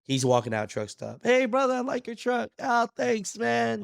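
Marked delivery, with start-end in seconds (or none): 1.2-2.5 much higher, cheery hail across a parking lot; buddy hailing a trucker, pitched high and bright; friendly trucker greeting. Retold or imitated. Greeting a trucker outside truck stop. 2.6-3.8 much higher, casual thanks; acting the driver's reply, much higher; trucker's easy reply. Trucker's reply, voiced by teller.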